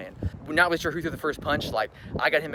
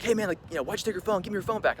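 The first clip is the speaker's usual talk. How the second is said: higher-pitched voice for the other man; imitated confrontation; 8 semitones higher, faster than usual. Man blocking the exit demands phone, imitated confrontation. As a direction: lightly mimic his demand, pitched up.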